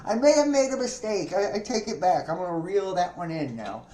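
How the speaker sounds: high, panicky mock backpedaling; scared voice. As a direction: squeak it out like a frightened witness. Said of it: "She's like".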